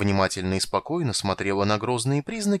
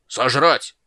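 loud, raised one-word bark; barked roar; 4 semitones higher, 8 dB louder than usual. A narrator's reading. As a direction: bark it like an order. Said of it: "рявкнул Молот так". (рявкая)

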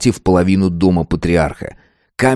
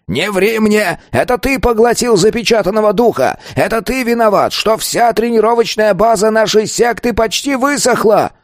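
high-pitched, raging elder; furious growling; 13 semitones higher than usual. A narrator's reading. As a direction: rage at the pupil on a strained high pitch. Enraged elder accuses the pupil. (гневный рык на высоких нотах)

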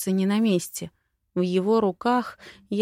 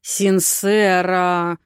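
slow, loud, drawn-out warning; simmering anger on a long-held name; starting to boil; 6 dB louder, much slower than usual. A narrator's reading. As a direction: stretch the name out with mounting exasperation. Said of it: "начал процесс закипания Котинус".

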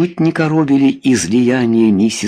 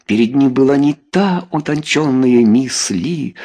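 ordinary delivery, nothing marked

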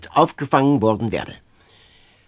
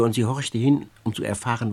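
usual speaking voice

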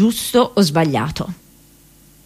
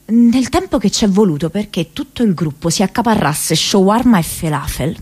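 usual speaking voice

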